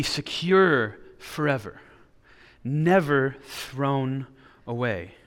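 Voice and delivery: never thrown drawn out very slowly; slow right down on never thrown; slow emphasis. Acted. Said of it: "preacher stresses the promise".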